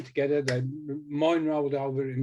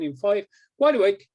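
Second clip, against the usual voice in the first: wake-up call; 6 semitones higher, 7 dB louder than usual. Acted. Hypnotist waking the subject.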